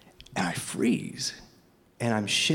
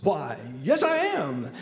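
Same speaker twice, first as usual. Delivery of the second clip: father's cordial voice; 3 semitones higher, slower than usual. Retold or imitated. Father confirming his name at the door, hammed-up cordial. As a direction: play him hammed-up and delighted, pitch leaping up. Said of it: "And he goes".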